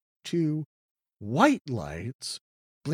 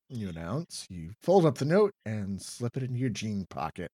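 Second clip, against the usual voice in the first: ordinary talk, nothing marked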